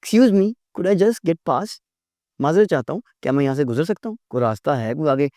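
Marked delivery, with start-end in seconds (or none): 0.0-1.8 slow, courteous ask; teacher showing the polite way to ask past; polite request. Shown as a demonstration. Polite and slow. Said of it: "ऐसे नहीं बात करते".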